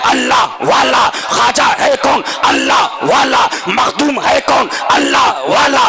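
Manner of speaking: rhythmic call-and-answer sermon chant; full-force preaching. Acted. Preacher answering a critic of the saints, rhythmic full-force preaching. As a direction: drive each refrain, building to a high shout.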